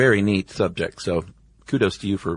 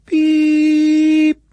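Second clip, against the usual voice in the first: long high beep tone; imitated beep; 8 dB louder than usual. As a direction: do a loud sustained beep. Imitating the numbers station tone.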